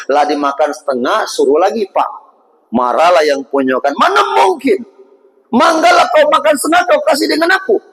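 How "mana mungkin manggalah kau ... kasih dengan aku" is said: teriakan marah, nada tinggi. Acted. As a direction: bentak si anak muda dengan marah. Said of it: "Marah dia". (pemilik kebun membentak dengan marah)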